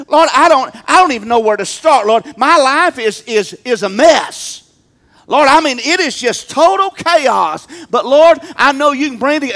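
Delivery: loud pleading prayer to the Lord; acted prayer. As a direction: cry out to God from a mess. Acting out a prayer.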